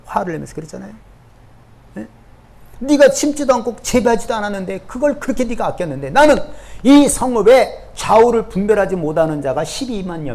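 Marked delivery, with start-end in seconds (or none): 2.8-10.3 God pleading. Acted. 하나님의 말씀 재연.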